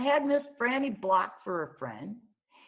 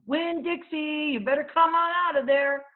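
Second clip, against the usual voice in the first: calling the dog out of the yard; hollered; 9 semitones higher, 6 dB louder than usual. Acted. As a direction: holler through the gate. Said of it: "went up to the gate and hollered".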